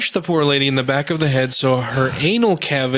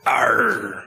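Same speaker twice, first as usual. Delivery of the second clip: high mock-pirate "arr"; playful pirate noise; pirate growl; 9 semitones higher than usual. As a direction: let out a cartoon pirate cry. Imitates the angry pirate.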